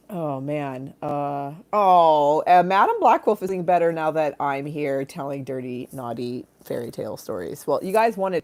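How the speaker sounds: ordinary talk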